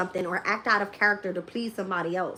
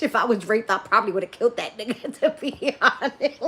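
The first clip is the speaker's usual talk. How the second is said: words stretched out by real laughing; laughing boast; 3 dB louder than usual. Real, not acted. Joking about what they'd have done, laughing boast. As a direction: break into laughter mid-sentence.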